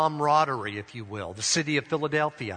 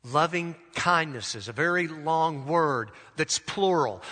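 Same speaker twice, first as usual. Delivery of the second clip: normal talking voice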